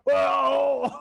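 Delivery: big laughing whoa; loud shocked whoa. Real, not acted. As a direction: shout it out in shock.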